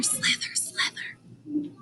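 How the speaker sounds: whispered "slither, slither" fading out; silent slither. Shown as a demonstration. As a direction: hiss it like a sneaking snake. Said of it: "slithering silently".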